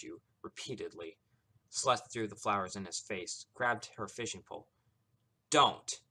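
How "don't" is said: DON'T punched loud and high; every word emphasized. A narrator's reading. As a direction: hit "Don't" as one blow. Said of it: "she emphasized every word".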